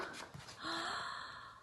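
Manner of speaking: sharp high gasp; startled gasp. Acted. Nora seeing the tiger, a sharp gasp. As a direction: gasp at the sight.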